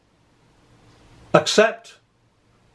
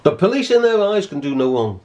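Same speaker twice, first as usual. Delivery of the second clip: ordinary talk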